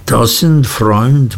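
ordinary delivery

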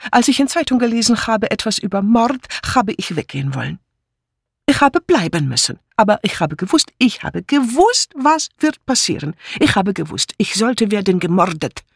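agitated foreign housemaid; agitated Mitzi. Acted. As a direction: insist dramatically like a frightened foreign maid.

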